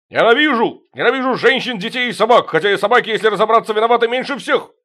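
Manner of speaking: loud high furious rant. Acted. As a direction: rage at the world suddenly sober. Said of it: "не пьяно и яростно". (яростный крик)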